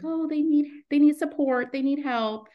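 high, earnest "they need help"; mock people-pleaser. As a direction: play your anxious helpful self. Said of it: "I'm like".

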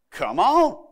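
casual Oklahoma "come on", lifting at the end; easygoing Oklahoma invitation; Oklahoma version. Acted. Jesus calling Peter onto the water, in a folksy Oklahoma way. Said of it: "that's the Oklahoma version of that".

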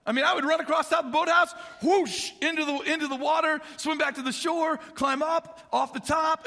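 fast, high, loud excited telling. Real, not acted. Excited retelling of playing.

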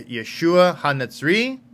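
ordinary talk

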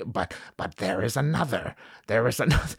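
light impression of a cryptic old mentor; a hint of a wizened teacher's halting voice; Yoda. Dying mentor hints to Luke, lightly imitated. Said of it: "when it comes time for Yoda to tell Luke".

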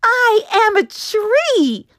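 high, loud slow proud shout. Acted. Juniper shouting proudly to the forest, loud and high. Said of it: "he shouted".

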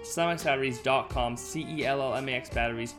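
upbeat sponsor read; hard sell ad. Acted. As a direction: read it like a radio ad.

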